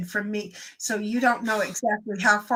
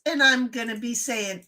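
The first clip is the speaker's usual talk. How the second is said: ordinary talk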